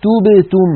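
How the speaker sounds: تبتم with pure close "u" vowels; correct damma. Shown as a demonstration. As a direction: round the lips for "u" in تبتم. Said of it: "بل نقول".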